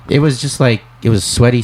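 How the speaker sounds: ordinary talk, nothing marked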